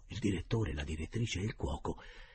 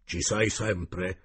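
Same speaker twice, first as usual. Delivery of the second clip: very slow, louder solemn call; solemn medium; 6 dB louder, much slower than usual. A narrator's reading. Cook summoning the spirit, slow solemn call.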